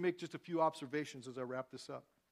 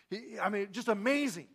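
ordinary delivery

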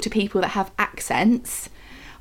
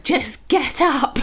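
mock exasperated husband; 6 semitones higher, much slower than usual. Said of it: "He's like".

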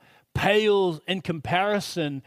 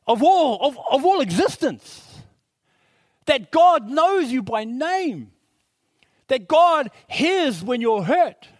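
fervent preaching; 6 semitones higher than usual. Acted. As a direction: build the sermon to a climax. Preaching at full force.

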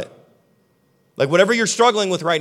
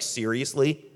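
questioning seriously; 5 semitones lower, 6 dB quieter, slower than usual. Retold or imitated. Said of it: "God's looking at you like".

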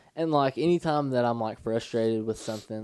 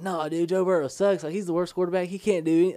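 higher, louder mock confident hot take; higher, louder mock gym argument about Joe Burrow; 5 semitones higher, 3 dB louder than usual. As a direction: argue it hard like a sports pundit. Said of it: "And I was like".

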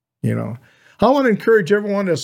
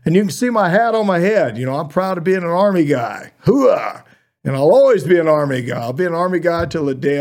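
proud, higher, louder army rallying voice; loud gung-ho soldier; 5 semitones higher, 5 dB louder than usual. Real, not acted. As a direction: fire them up like a proud soldier. Proud Army veteran rallying listeners.

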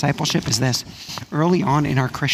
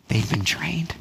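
whisper; 6 dB quieter, slower than usual. Acted. Quiet answer to question.

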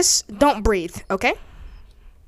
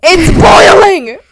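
high, louder cries about boiling soup; pained complaint; 7 semitones higher, 6 dB louder than usual. Acted. Player wailing at the DM about scalding soup, loud mock pain. Burned by thrown hot soup.

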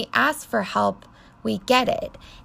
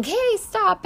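retold yell; 11 semitones higher than usual. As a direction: imitate calling after a runaway toddler, pitched way up. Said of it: "I'm yelling".

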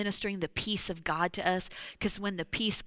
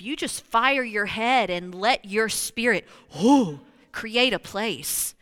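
loud preaching; 7 dB louder than usual. Acted. Preaching at full force.

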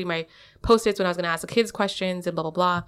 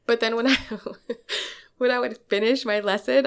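ordinary talk, nothing marked

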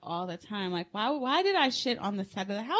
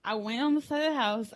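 normal talking voice

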